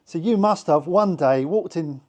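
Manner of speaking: ordinary talk, nothing marked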